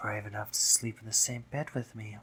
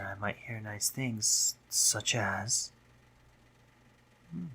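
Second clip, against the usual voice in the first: ordinary talk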